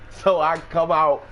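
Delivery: ordinary delivery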